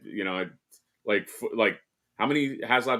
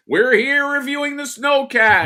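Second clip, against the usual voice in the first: high, loud hammed-up announcement; imitating an over-excited reviewer; mock video host; 9 dB louder than usual. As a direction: belt it out like a show opener. Imagined host suddenly announcing review.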